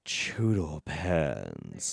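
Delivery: very slow, low sultry purr; seductive voice. Pretend. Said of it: "In your most seductive, most seductive voice".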